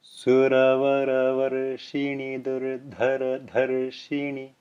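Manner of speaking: slow chant-like recitation with tapped r sounds; Sanskrit recitation. Shown as a demonstration. Teacher reciting a Sanskrit example line to the class. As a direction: recite slowly with the tongue lightly tapping each r. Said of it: "give a Sanskrit example".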